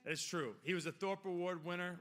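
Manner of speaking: normal talking voice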